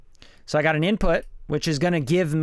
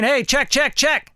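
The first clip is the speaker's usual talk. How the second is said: high, louder mic check; 9 semitones higher, 4 dB louder, slower than usual. Shown as a demonstration. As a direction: pitch it up to test the input. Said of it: "you're gonna hear it as it comes in".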